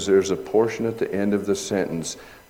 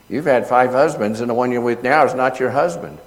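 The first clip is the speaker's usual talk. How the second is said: louder quote; 4 semitones higher, 7 dB louder than usual. Retold or imitated. Jesus quoted to the woman.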